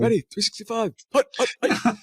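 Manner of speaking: high, loud mock quarterback snap count; football call-out. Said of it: "sounds like a, like a football, uh, call out".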